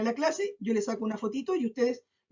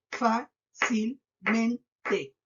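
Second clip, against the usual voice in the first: Fácilmente in four slow, separate beats; syllable by syllable; much slower than usual. Shown as a demonstration. Teacher counting four syllables for pupils, very slow, syllable by syllable. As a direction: break it into fá, cil, men, te. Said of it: "Cuatro sílabas".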